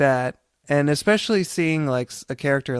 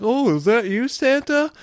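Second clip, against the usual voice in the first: much higher, rising sitcom-style "Santa?"; joking sitcom Eddie; 10 semitones higher than usual. Retold or imitated. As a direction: play it for the studio audience. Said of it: "the joke for the studio audience".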